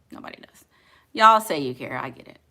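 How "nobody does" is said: whispered aside. Real, not acted. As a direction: breathe it under your breath. Muttered aside.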